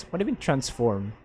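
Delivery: ordinary talk, nothing marked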